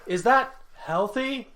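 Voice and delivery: high, loud, slow quoted question; mock concerned question. Quoting a favorite banter line, exaggerated high questioning. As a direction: ask it high and drawn out, hamming it up.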